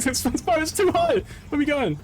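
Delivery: high-pitched playful panic; alarmed. Real, not acted. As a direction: squeak in alarm at the height. Alarmed as boat rises too high.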